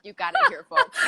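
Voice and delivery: high, loud mock announcement; mock announcer. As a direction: play it up for the audience. Joking to audience after gasp.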